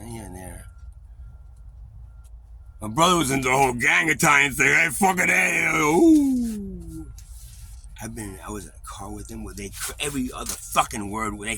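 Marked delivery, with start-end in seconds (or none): 4.7-7.1 slow, loud swaggering call; tough guy imitation. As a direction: ham up the gang bravado. Imitating Italian gang talk.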